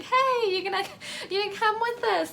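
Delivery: high, hammed-up hopeful plea; mock hopeful. Wrecker asking Crosshair to come along, mock hopeful and high.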